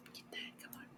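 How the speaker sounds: urgent little whisper to the tool; whispered coaxing. Real, not acted. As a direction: whisper it, willing it on. Urging the eraser, whispered.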